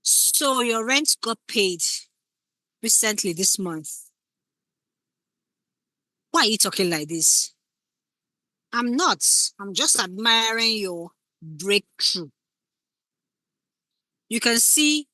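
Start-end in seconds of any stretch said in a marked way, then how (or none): 0.0-7.5 slow, loaded hint about the rent; suspicious partner, insinuating; pointed insinuation. Shown as a demonstration. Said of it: "when you think of a subtext". 8.7-12.3 slow, mock-innocent reply; innocent deflection. Shown as a demonstration. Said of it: "when you think of a subtext".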